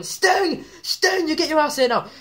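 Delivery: high, loud mock yell; shouting. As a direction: imitate a furious wrestler yelling a challenge. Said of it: "He's shouting".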